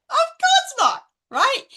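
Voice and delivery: emphatic of course not. Real, not acted. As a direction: scoff at the very idea. Rhetorical answer to own question.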